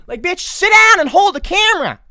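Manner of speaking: loud, high-pitched comic outburst; exasperated scolding. Pretend. Viewer scolds influencer over her camera, exasperated yell. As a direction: yell it at them in mock outrage.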